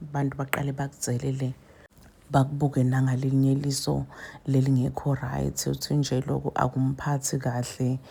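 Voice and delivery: ordinary talk, nothing marked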